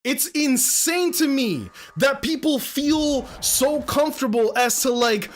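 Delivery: furious streamer ranting about backseating; heated rant. Real, not acted. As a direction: let it boil over, pitch climbing. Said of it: "I'm getting heated".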